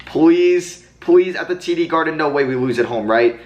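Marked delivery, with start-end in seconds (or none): 0.0-2.2 begging. Real, not acted. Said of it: "can we please force a game seven".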